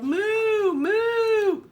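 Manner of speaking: high, loud mooing cows; yelling cows. Acted. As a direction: bellow like excited cows. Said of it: "stomped and stomped and yelled".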